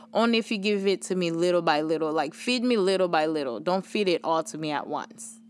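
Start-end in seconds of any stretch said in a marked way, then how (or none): none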